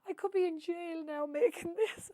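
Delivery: high, mock-tearful wail to herself; half-imitating her own crying over the pan; tearful lament. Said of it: "and I'm crying into it".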